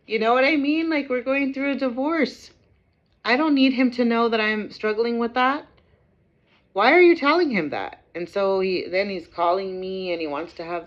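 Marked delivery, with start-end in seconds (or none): 0.0-8.0 upset ranting. Real, not acted. Ranting about the broken promise.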